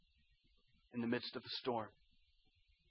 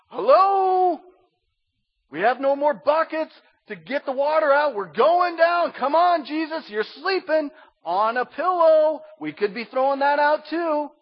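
much higher and louder, frantic sailors in a sinking boat; panicked disciples; 11 semitones higher, 9 dB louder than usual. Retold or imitated. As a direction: squeal out the panic of the drowning crew.

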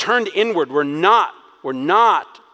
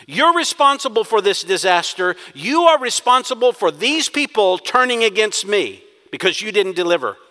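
louder, rising to a fast finish; frustrated accusation; 5 semitones higher, 5 dB louder than usual. Acted. Moses blaming God for failed deliverance, frustrated. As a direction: pile on the blame. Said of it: "It is a frustrated accusation".

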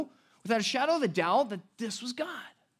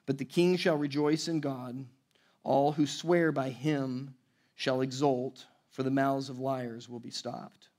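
lower, measured reading of the psalm; scripture reading; 5 semitones lower, 3 dB quieter than usual. Acted. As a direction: read the verses with weight, like Scripture. Reads the psalm aloud.

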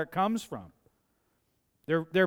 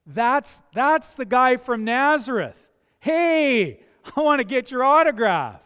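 starstruck fan; 6 semitones higher, 6 dB louder than usual. Acted. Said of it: "he's like".

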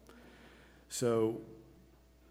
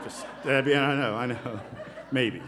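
laughing; 3 semitones higher, 6 dB louder than usual. Real, not acted. Joking about his polo shirt.

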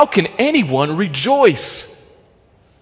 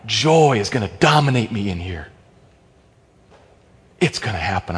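ordinary delivery

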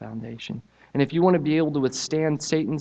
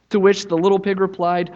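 lively storybook reading, pitched up; story narration; 5 semitones higher, 6 dB louder than usual. A narrator's reading. Preacher reading the pigs story to church, animated. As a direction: tell it like reading to children. Reads children's story aloud.

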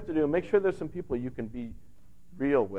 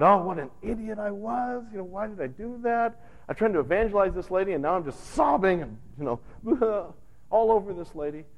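higher, fast embarrassed self-scolding; mock self-reproach; 9 semitones higher than usual. [name] berates herself after crying on the neighbor, mock dismay. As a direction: groan at your own blunder, playfully.

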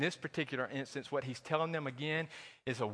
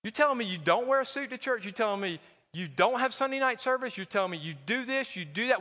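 higher, rising scandalised questions; mock outrage; 7 semitones higher than usual. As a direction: play the shocked churchgoer. Mock disbelief of legalists.

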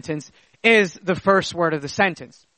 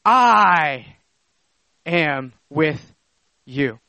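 long ringing "I", then spaced, falling "am with you"; stress on I; 4 semitones lower, much slower than usual. Shown as a demonstration. Preacher quoting Jesus after "Behold", weighty stressed "I". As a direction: hit "I", pause, then lower each word. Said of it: "brought to the very beginning".

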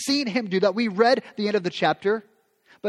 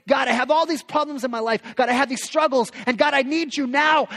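pleading prayer; 6 semitones higher than usual. Retold or imitated. Voicing a desperate prayer.

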